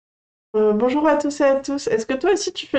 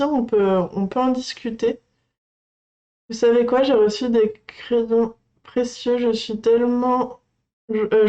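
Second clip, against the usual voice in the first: ordinary delivery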